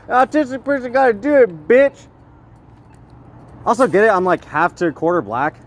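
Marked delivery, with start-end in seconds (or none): 0.1-2.1 high-pitched mock yell at a rival; over-the-top taunting voice, pitched high; acted yelling. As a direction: ham it up like a trash-talking heckler.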